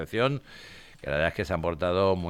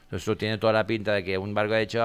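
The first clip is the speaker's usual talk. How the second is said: normal talking voice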